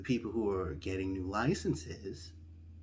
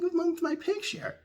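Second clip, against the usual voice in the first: slow, much higher bewildered squeak; high confused; slower than usual. Retold or imitated. At the DMV desk, slow high bewildered squeak.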